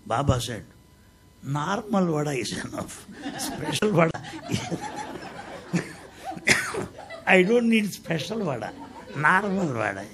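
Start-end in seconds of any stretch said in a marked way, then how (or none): 1.4-10.0 chuckling through the words; laughing joke. Retold or imitated. Baba teasing the lady about special vada, laughing. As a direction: tease her with a laugh.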